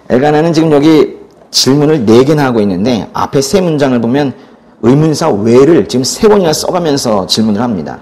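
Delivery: ordinary delivery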